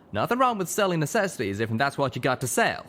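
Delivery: hastily defending, lightly voiced; lightly voiced Steelhooves. A narrator's reading. Steelhooves character voice.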